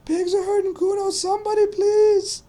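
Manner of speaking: very high, loud mock scream; child screaming for help. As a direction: scream it like a kid, dragging the last words. Boy calling out for help.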